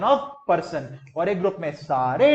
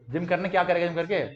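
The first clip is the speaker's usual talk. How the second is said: ordinary talk, nothing marked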